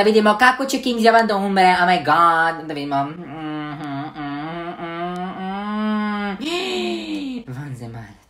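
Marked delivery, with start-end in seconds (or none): 6.4-7.5 shocked gasp. Real, not acted. Sees alien result.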